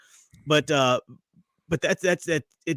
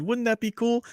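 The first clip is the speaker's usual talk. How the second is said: higher-pitched mock enthusiasm; mocking Filoni; 10 semitones higher than usual. Retelling Filoni's idea to co-host, mock-eager imitation. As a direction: parody an eager pitch, half laughing. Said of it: "I said".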